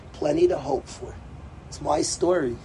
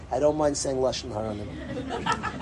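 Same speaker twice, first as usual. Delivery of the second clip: ordinary talk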